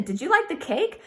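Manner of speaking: cheerful teacher question with a rise; bright question. Shown as a demonstration. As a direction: ask it lightly and brightly. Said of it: "Yes, I'm asking a question".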